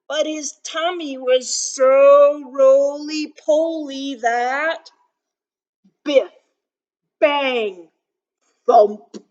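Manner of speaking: picture book read-aloud. A narrator's reading. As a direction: read it out big for little listeners. Reads picture book aloud to children.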